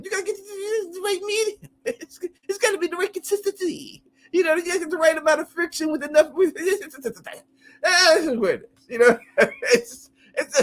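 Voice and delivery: high-pitched, barely holding in laughter; laughing while talking. Real, not acted. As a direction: try to explain through a real fit of giggles. Cracks up explaining innuendo.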